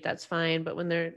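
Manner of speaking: ordinary talk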